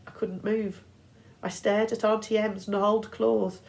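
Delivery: ordinary delivery, nothing marked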